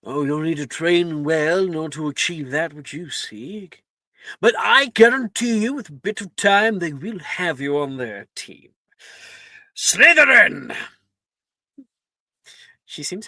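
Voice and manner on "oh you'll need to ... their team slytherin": Sorting Hat voice. Acted. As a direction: counsel the student, then proclaim it.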